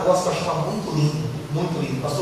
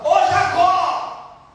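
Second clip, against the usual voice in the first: drawn-out call, higher; 9 semitones higher, much slower than usual. Retold or imitated. Someone calling Jacob from afar, drawn out and higher. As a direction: call his name across the room. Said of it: "alguém chamava".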